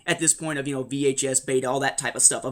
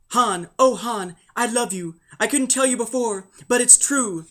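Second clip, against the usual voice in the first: higher, mock-romantic heroine voice; Leia; 5 semitones higher than usual. Princess confessing love to Han, mock-earnest. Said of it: "Leia saying".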